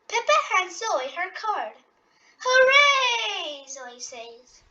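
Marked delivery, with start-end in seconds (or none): 2.4-3.7 high, loud hooray; cheer. Acted. Zoe cheering about her valentine, high and loud. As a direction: cheer it out with delight.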